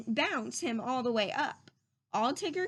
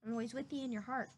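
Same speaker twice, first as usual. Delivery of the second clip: put-on soft, gentle comfort to a child; quiet loving goodbye; tender reassurance; 7 dB quieter, faster than usual. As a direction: hush it like a promise to a small friend. Tender parting words.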